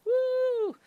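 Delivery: sudden very high whoop; hype cheer. Real, not acted. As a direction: cheer the raid in with a big whoop. Hyping up the loudest raid.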